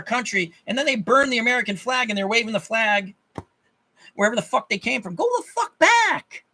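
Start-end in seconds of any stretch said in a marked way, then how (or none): 5.1-6.4 high, slow furious yell; angry shout. Real, not acted. Vlogger ranting at immigrants, high slow furious shout. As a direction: spit it out at them, much higher and louder.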